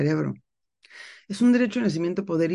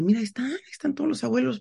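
más agudo y rápido, maravillada; asombro emocionado; 8 semitones higher, faster than usual. Retold or imitated. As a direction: dilo rápido, con asombro infantil. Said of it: "yo los veía y decía". (recuerda la visión de los abuelos, con voz aguda y emocionada)

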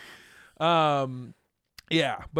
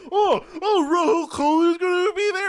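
loud, high-pitched mimicry of an excited fan; exaggerated teasing parrot of the co-host; mocking imitation; 14 semitones higher, 7 dB louder than usual. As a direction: throw their words back in a squealing mock voice. Mocking co-host's excited question back.

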